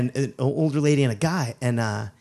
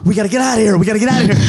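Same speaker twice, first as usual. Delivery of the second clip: loud, high, hurried plea to leave; retold panicky shout; panicked urging; 7 semitones higher, 7 dB louder, much faster than usual. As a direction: urge the getaway in mock panic.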